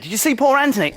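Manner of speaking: ordinary talk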